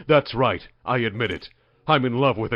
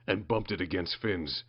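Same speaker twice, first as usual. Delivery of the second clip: ordinary talk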